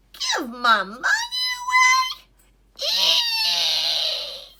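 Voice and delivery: shrill loud squeal of disbelief; shocked duck. Acted. Duck hears he must give money away, horrified high shriek. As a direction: squeal in horror like a cartoon duck.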